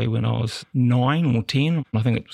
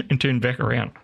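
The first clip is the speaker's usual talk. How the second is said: ordinary delivery, nothing marked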